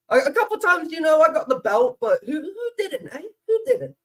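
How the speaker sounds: high, loud laughing brag; high giggly shrug-off; laughing joke. Real, not acted. Joking about getting the belt.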